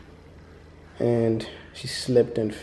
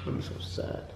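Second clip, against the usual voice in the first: whispered sadness; 11 dB quieter than usual. Real, not acted. Baby just busted her lip.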